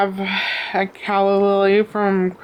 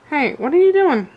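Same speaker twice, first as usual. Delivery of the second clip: scolding a pet; 8 semitones higher than usual. Real, not acted. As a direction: call out sharply to stop mischief.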